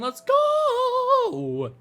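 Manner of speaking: long, high, sung-out holler; hyped cheer. Pretend. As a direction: stretch it into a soaring cheer. Cheers education news.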